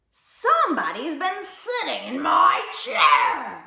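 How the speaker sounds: slow, loud, grouchy growl; grouchy bear growl. A narrator's reading. Big bear finds his chair used, growling grouchily. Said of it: "growled the big bear in his great big grouchy voice".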